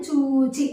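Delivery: చూచి with long చూ and an easy single చ; light single చ. Shown as a demonstration. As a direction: glide lightly through చూచి, no pressure. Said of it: "చాలా తేలికగా పలుకుతున్నాం".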